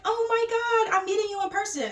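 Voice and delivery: high, loud imitation of an excited fan; starstruck fan. Girl at the party meeting a TikToker, high starstruck gushing. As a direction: gush like a fan meeting an idol.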